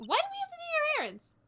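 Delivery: high, loud, rapid protest; indignant outburst. Acted. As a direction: yell the complaint in outrage. Character protests at being given errands.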